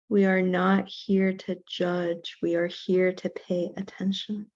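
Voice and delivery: slow, measured meditation voice with long pauses; guided meditation. Real, not acted. Teacher leading a class in self-compassion breathing, guided meditation. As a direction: count the breath out evenly, unhurried, guiding them into stillness.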